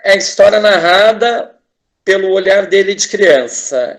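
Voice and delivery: ordinary delivery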